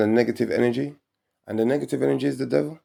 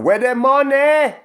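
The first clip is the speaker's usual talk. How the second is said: indignant demand; 13 semitones higher, 7 dB louder, slower than usual. Real, not acted. Rhetorical demand, high and loud.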